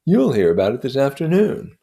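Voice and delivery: lightly voiced proud storyteller, rising; boasting of medals, building up; Gadsby telling his war tale. Gadsby boasting to Nick of his war medals, proud.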